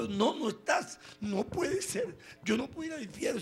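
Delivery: high wailing mock sob; mock crying. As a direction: whine it like a man in tears. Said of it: "Se puso a llorar Narciso".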